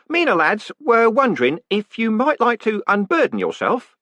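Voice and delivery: high, bright-voiced jolly warder; cheerful warder. Warder reporting the prisoner's words to the condemned man, cheerfully. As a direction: play the polite prison warder chatting brightly.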